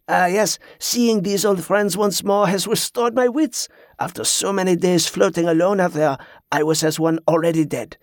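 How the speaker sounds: French pirate. Acted. Pirate explains himself to the captain, relieved French accent. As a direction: speak as a grateful French pirate.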